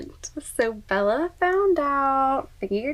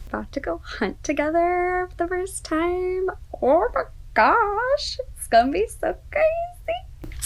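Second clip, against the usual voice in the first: excited gushing; 8 semitones higher than usual. Real, not acted. Reader looks ahead to their first hunt together, excited gushing. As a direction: gush with pitch soaring.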